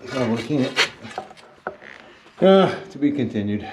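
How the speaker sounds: winded after digging; breathless from hard work; out of breath. Real, not acted. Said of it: "Whew, I'm out of breath".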